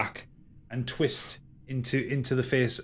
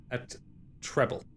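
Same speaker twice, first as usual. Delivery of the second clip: ordinary talk, nothing marked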